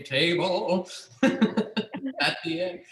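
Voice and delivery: drawn-out words broken by real laughter; laughing. Real, not acted. Pitching a dinner-party finale to the playwright, laughing. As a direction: laugh your way slowly through it.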